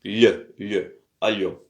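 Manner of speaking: "Le, le" as isolated lye, then "Allo" as a-lyo; soft l drill. Shown as a demonstration. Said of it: "ils ne disent pas allô, mais allo".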